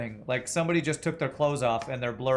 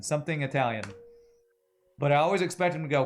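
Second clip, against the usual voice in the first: usual speaking voice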